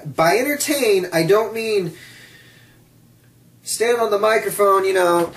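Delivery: normal talking voice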